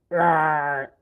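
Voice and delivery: loud, high hammed-up roar; monster roar. Fake roar.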